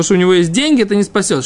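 usual speaking voice

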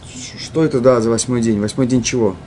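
ordinary delivery

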